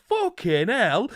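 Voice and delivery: slow, high northern English outburst; northern English accent. Retold or imitated. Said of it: "in his northern accent".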